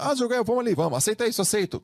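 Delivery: high, coaxing voice of a pushy friend; sing-song begging to come along; mock pleading friend. Friend begging Jogael to come out, high coaxing voice. Friends pressuring him to say yes.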